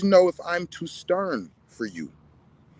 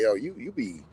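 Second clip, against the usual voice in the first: lower, mock-hesitant critic voice; hesitant critic impression in a lower voice; imitating a critic; 9 semitones lower than usual. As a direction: play a fumbling critic, voice dropped low. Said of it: "And other people like".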